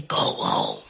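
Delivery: very deep, low demon growl. Acted. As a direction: drop into a deep monster voice. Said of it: "a demon in the middle of the night say".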